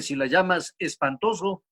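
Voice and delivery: usual speaking voice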